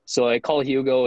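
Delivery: normal talking voice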